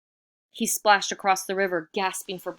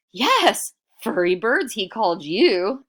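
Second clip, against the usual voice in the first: loud, higher egging-on shout; 6 semitones higher, 9 dB louder than usual. Acted. Iktomi goads the bats against the boulder, shouting. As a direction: egg the bats on at full voice. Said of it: "Iktomi shouted".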